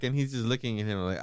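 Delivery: ordinary delivery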